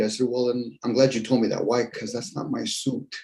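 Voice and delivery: ordinary talk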